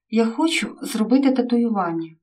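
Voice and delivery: plain reading voice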